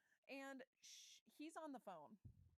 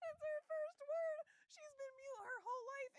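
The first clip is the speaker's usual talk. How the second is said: laughing hysterically; 8 dB louder than usual. Real, not acted. Hysterical over absurd plot twist.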